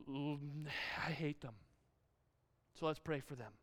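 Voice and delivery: low, soft confession; mock venting. Gossip sharing a prayer request about disliked person, hushed low. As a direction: drop to a hushed aside.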